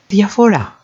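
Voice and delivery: diaphora as thia-fo-RA, high then falling; high-pitched stress on the RA of diaphora; diaphora, weight on the last syllable; stress on final syllable. Shown as a demonstration. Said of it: "same words being repeated here".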